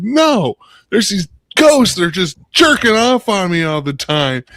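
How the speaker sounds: high, loud comic distress; hammed-up frantic complaint; freaking out. Man to coworkers about ghosts, loud high panic. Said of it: "he's, like, freaking out".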